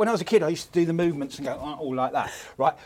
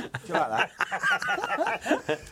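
normal talking voice

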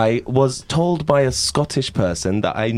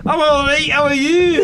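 put-on high, laughing Scottish accent; 13 semitones higher, 4 dB louder than usual. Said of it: "You sound like a right Scots man".

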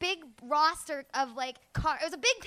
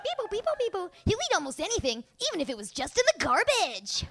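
toy phone voice; 6 semitones higher than usual. Retold or imitated.

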